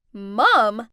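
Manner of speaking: loud, stunned question; disbelieving cry; shocked shout. Acted. Friends learning the maid is her mom, shocked shout. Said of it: "Jasmine, Brent, and Sophia all shouted".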